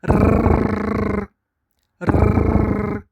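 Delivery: long trilled rolled R, rrr, tongue rattling; rolled R drill. Shown as a demonstration. Teacher drilling students on the Spanish rolled rrr. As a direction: roll the rrr hard and long. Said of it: "Time to practice".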